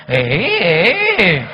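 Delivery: loud, indignant eh eh eh; protesting grunts. Retold or imitated. The husband loudly cutting off his wife's praise of her boss. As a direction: butt in loudly to stop her.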